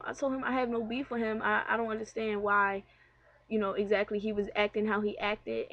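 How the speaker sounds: usual speaking voice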